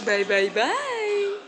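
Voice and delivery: drawn-out slow goodbye; slow sing-song bye. Real, not acted. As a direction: stretch each bye slowly. Waving bye to camera.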